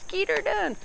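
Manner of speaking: very high goofy squeal; playful high-pitched holler; comic catchphrase. Acted. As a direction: squeak it out as a silly cheer. Comic catchphrase after "get it done.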